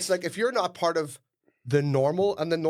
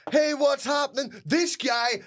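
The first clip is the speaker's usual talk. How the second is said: mock influencer; 6 semitones higher than usual. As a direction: play the fake-charity vlogger to the camera. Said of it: "there's guys who go around and go".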